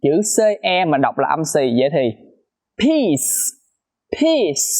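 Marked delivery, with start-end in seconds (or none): 2.8-4.7 "peace" with an aspirated P and a sharp final s; hissed s. Shown as a demonstration. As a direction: make the s hiss clearly. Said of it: "Các bạn nghe rõ cái âm xì".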